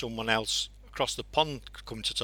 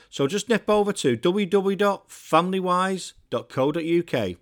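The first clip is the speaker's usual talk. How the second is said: louder upbeat ad read; host reading the sponsor message, louder ad voice; 3 semitones higher, 6 dB louder than usual. Acted. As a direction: pitch the sponsor like a radio ad. Sponsor read inside show.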